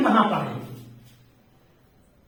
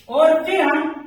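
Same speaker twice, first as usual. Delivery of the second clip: normal talking voice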